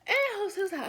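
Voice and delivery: ordinary delivery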